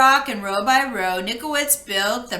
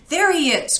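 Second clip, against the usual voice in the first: relieved cry; 5 semitones higher than usual. Acted. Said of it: "cried Bork and Gork".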